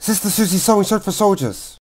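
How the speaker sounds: tongue twister at top speed; very fast. Shown as a demonstration. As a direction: rattle it off as fast as possible. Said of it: "faster and faster".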